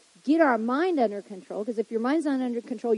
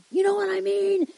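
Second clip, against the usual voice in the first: mock freak-out; 9 semitones higher than usual. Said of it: "they're gonna do the freak out".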